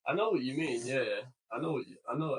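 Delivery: ordinary delivery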